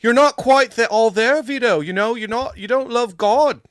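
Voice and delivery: high, loud, slow exaggerated Canadian accent. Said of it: "that's a terrible Canadian accent".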